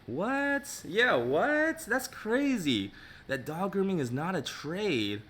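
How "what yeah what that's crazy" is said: high, incredulous what; outraged disbelief rising high; shocked. Real, not acted. Reacting to a viewer's quote, shocked and incredulous.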